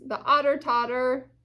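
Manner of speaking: plain reading voice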